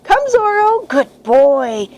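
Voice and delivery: high, sing-song call to the puppy; cheery praise for a puppy. Shown as a demonstration. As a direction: coax the pup toward you happily. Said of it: "with the word come".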